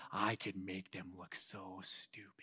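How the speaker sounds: sly whispered threat. Pretend. Youth pastor whispering a gossip's threat. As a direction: hiss it low like a schemer.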